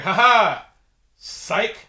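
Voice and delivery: loud, high mock-triumphant taunt; spring the trick with a gleeful cry; gloating. Acts out her gloating reveal.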